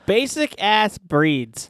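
slowly savoured; slow drawl. Pretend. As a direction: stretch every word out.

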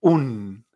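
"un" said as a plain Spanish oon; wrong Spanish-style pronunciation. Shown as a demonstration. Teacher showing learners how not to pronounce it, Spanish-style "un". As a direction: say "un" with a pure u, no e. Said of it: "esto no se va a pronunciar".

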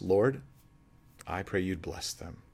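slow, soft and sincere prayer. Shown as a demonstration. Pastor modeling a blessing prayer, soft and slow. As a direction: pray it gently, meaning it. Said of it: "It goes like this".

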